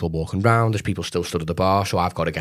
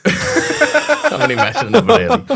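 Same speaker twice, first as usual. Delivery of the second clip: high, slow words through laughing; real laughter; 7 dB louder, slower than usual. Real, not acted. Real laughter at own joke.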